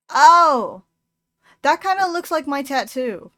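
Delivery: as if spotting something familiar; surprised delight. Real, not acted. To viewers, noticing the creature looks like her tattoo, surprised.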